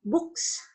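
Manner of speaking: "Books" ending in a voiceless s. Shown as a demonstration. Teacher explaining voicing to students, "Books" with voiceless s. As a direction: end "Books" on an unvoiced hissed s. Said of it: "jadi bunyi sehnya juga voiceless".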